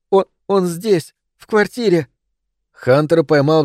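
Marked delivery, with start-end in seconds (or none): 0.1-2.1 высокий дрожащий шёпот, медленно; шепчи, дрожа от ужаса; trembling whisper. Acted. Molly repeats, terrified. (дрожащий испуганный шёпот)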